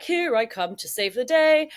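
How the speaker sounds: slow, loud mock-heroic announcement; eager rescuer. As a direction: proclaim it like a self-mocking superhero. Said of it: "I definitely tried to rescue".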